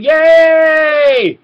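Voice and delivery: high, loud cheering yay; mock crowd cheer. The passengers cheering the train-control news, high loud cheer. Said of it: "everyone starts cheering".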